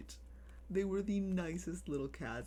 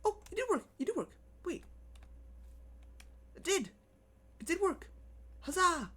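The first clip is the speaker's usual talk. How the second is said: excited squeal; 13 semitones higher, faster than usual. Real, not acted. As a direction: squeal with surprise it worked. Joy that it finally worked.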